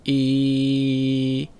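a low throaty "一" placed deep in the throat; low resonance demo. Shown as a demonstration. Speech therapist showing a low placement. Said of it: "比如说我现在发一个很简单的".

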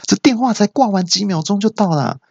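high-pitched amazed nurse; astonished. Acted. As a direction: exclaim in exaggerated surprise like the nurse. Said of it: "那陈小姐就很惊讶说". (学护士惊讶地高声说)

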